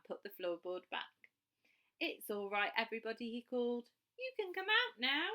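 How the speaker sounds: put-on lively read-aloud for children; storytelling. A narrator's reading. Storyteller reading a picture book aloud to young children. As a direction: tell the bedtime story with bright expression.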